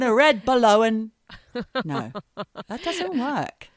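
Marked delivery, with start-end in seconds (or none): 0.4-1.1 BALLOON said as "ba-lo-en"; drawn-out BALLOON with an oh; BALLOON rhyming with bone; mock mispronunciation. Shown as a demonstration. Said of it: "why isn't it balone".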